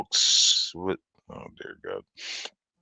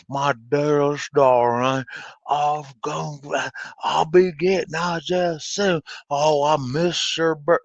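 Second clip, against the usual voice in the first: imitating Nic Cage's Southern accent; 4 semitones higher than usual. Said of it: "I can't really imitate it".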